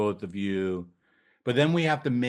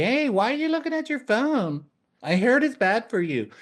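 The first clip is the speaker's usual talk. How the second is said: much higher, put-on nagging voice; nagging partner; 14 semitones higher, 4 dB louder than usual. Partner one nagging the other about their phone, high and whiny. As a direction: pester them about the phone.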